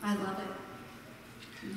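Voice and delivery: barely a whisper, slow; awed murmur; hushed. Real, not acted. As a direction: breathe it out softly.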